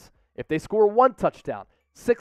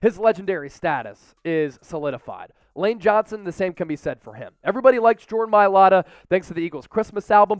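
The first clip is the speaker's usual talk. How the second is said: ordinary delivery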